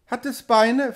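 put-on high, childlike questioning voice; questioning small child. A narrator's reading. Child asking mother about the sea, high small voice. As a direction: ask it like a little girl, pitched high.

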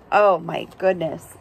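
ordinary delivery, nothing marked

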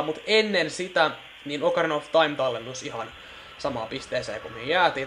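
usual speaking voice

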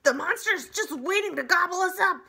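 panicked high shriek, loud; groundhog shriek. A narrator's reading. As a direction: shriek in terror before diving away. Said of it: "shrieked Dig Down".

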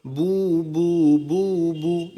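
long "বু" with rounded lips; slow, held "বু" drill; rounded pesh sound. Shown as a demonstration. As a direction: round the lips and send each "বু" forward. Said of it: "ঠোঁট কিন্তু গোল হবে".

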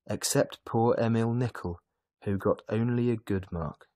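ordinary delivery

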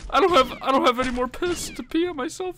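high, loud half-laughing panic; panicked high cries. Real, not acted. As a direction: shriek in laughing panic under fire. Under fire, panicked laughing.

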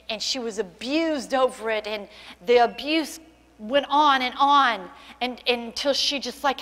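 ordinary delivery

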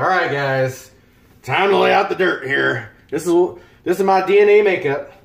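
hammed-up imitation of a tipsy streamer; mock impression of streamer. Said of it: "I know you don't talk like that".

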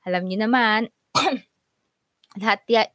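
ordinary talk, nothing marked

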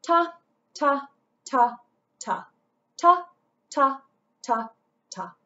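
each ta spoken on the beat; rhythm syllables. Shown as a demonstration. Teacher modelling quarter notes for the class. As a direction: chant ta evenly, one per beat. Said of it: "we would read 'em like this".

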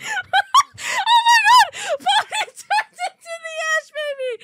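high, loud laughing exclaim; excited laughing shout. Real, not acted. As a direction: squeal in delighted surprise. Laughing surprise at scene.

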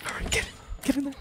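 whispered urgent urging; hushed. Real, not acted. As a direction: hush it, urgent and scared. Hiding from monster.

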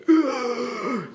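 sharp high gasp of horror; shocked gasp. Retold or imitated. Students gasping at the shaved-head newcomer, a high shocked gasp. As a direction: gasp like prim students seeing a skinhead. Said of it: "ils ont fait".

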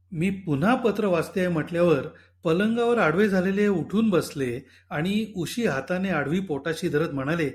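usual speaking voice

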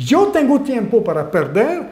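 normal talking voice

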